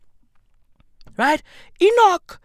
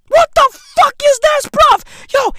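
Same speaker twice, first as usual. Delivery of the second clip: much higher and louder, incredulous; exasperated outburst; 7 dB louder than usual. Real, not acted. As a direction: yell it in disbelief. Exasperated at convoluted job title.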